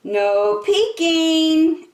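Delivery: high, slow, playful mermaid voice; Ariel voice. A narrator's reading. Storyteller as Ariel telling Flounder not to peek. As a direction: stretch it out teasingly, high and light. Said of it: "Ariel told her friend Flounder".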